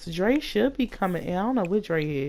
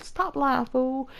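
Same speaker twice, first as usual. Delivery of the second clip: higher-pitched, incredulous acted reply; mock disbelief; 7 semitones higher, slower than usual. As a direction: play the friend not buying it, pitched up. Acted disbelief at friend's story.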